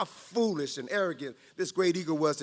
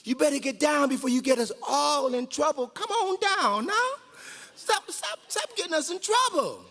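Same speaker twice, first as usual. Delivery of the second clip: much higher, pleading and panicky; scared eagle begging its rebel to come down; frightened shout; 8 semitones higher than usual. Acted. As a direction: beg the eagle to stop, anxious. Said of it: "one of the eagles ... shouted".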